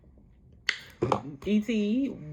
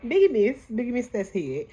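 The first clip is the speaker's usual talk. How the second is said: loud, high cry of disbelief; excited outburst; 5 semitones higher, 7 dB louder than usual. Real, not acted. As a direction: shout it out, half laughing. Excited recap of the swing.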